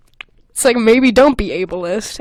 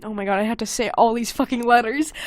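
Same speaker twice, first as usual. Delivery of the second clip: normal talking voice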